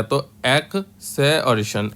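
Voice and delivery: "एक से और शन" spoken slowly, each part separate; split into parts. Shown as a demonstration. Teacher splits the word for students, slowly and separately. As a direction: spell out "एक से और शन" piece by piece, slowly. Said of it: "एक्सेशन हो गया".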